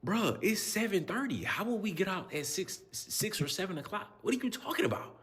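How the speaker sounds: high, incredulous questioning; baffled coach. Retold or imitated. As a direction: play up disbelief. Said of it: "I was like".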